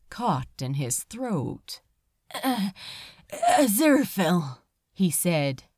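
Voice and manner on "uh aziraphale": unsteady voice. A narrator's reading. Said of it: "His voice was unsteady".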